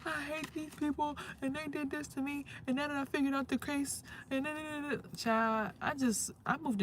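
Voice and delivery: lightly mocking grievance; mock complaining. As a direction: play the self-pitying victim. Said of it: "I can sit here and be like".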